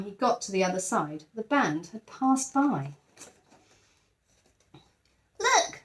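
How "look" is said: put-on much higher child's call; small boy. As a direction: point it out like an eager little boy. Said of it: "said a small boy".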